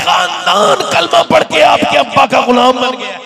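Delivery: building to a high, loud cry; fervent preaching shout. Acted. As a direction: cry it out to the crowd. Climax of sermon, shouting. (ऊँची आवाज़ में जोशीली पुकार)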